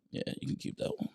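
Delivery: soft whispered reply. Real, not acted. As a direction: whisper it back.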